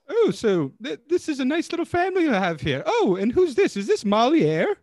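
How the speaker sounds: chirpy lady come to buy; high customer voice. Acted. Same lady character.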